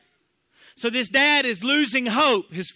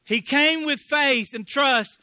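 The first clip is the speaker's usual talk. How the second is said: ordinary talk, nothing marked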